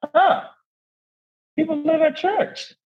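put-on a small boy discovering something astonishing; little boy amazed. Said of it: "little Ajani's like".

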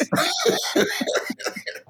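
high, breathy burst of laughter. Real, not acted. Comedian laughing at his own comeback, high burst. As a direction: crack up mid-story.

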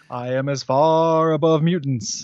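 lightly imitated Apocalypse, slow and grand; a hint of a cosmic villain, slowed down; vast deep cosmic voice. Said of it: "and he goes".

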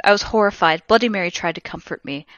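normal talking voice

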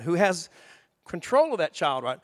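usual speaking voice

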